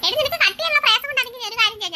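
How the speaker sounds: normal talking voice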